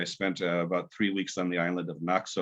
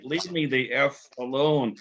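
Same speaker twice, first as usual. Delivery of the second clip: mock cursing; 7 semitones higher, slower than usual. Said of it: "swore and cursed at him".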